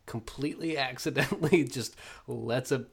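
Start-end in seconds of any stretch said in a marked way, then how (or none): none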